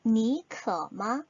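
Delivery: 니可마 drawn out very slowly, tone by tone; slow careful Chinese demo; very slow Chinese model. Shown as a demonstration. Teacher models the question very slowly for learners. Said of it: "그렇다면 어떻게".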